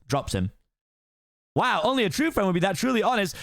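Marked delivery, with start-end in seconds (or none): 1.5-3.4 high fast-talking donkey voice; excited Donkey. Acted. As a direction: gush admiringly at the ogre. Donkey flattered.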